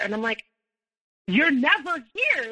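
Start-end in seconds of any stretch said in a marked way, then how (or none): 1.3-2.5 higher-pitched, drawn-out exasperated protest; getting mad. Retold or imitated. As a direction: retell a rising, frustrated objection. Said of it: "I'm getting mad".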